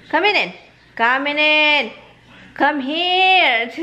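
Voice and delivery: high calling voice to the dog. Retold or imitated. Coaxing the dog through the screen door, calling. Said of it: "Ang sabi ko".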